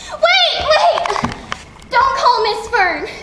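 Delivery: high, loud cry to stop her; panicked shout. Acted. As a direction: cry out in alarm to stop the call. Child stops mother phoning.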